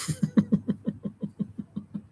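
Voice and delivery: long, soft, high breathy laugh; real giggle. Real, not acted. Streamer laughing with chat after asking about being Dutch, giggling. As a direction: dissolve into quiet, airy giggles.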